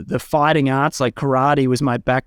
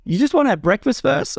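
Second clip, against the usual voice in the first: dry mock question, pitched higher; sarcastic; 7 semitones higher than usual. Uncle teasing his eager nephew, lightly sarcastic imitation. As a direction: tease him like he's getting ahead of himself. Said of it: "my uncle's super sarcastic".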